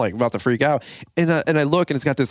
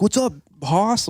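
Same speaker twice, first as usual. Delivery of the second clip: higher, slow jovial greeting; a big pal clapping your back; back-slapping buddy; 7 semitones higher, slower than usual. Retold or imitated. Grabby stranger greets him, slow jovial imitation. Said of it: "they got the bear paw and they're like".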